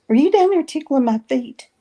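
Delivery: acting out her demand for an answer; retold accusing question. Sister confronts her husband over tickling, mock accusing question.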